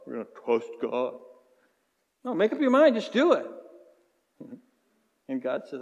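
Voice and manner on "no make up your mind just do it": impatient demand. Acted. Preacher acting out impatience with God.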